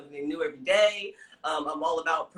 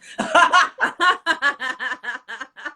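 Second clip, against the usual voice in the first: laughing; 6 semitones higher, 9 dB louder than usual. Real, not acted. Laughing at own lisp.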